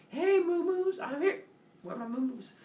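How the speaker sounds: high singsong calling; doting cow owner. Acted. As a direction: call pets in a silly high voice. Owner calls to his beloved cows.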